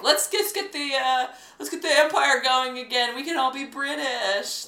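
put-on high, loud over-eager teen fans; mock American teens. Mocking Tumblr teens keen on Britain, high and gushing. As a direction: gush like an Anglophile teen, hammed up. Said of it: "American teens are like".

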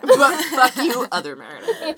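loud slow laughing jab; laughing, mock-curse it. Real, not acted.

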